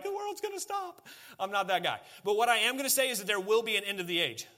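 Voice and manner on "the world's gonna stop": high, mocking doomsayer voice; lightly hammed-up prophet of doom; mock doom and gloom. Preacher mocking a doomsayer, high and hammed-up. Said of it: "I'm not trying to be doom and gloom and be like".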